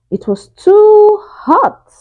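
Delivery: high, slow cry of too hot. A narrator's reading. Goldilocks tasting the porridge, high and slow. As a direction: Goldilocks squeals at the scalding porridge.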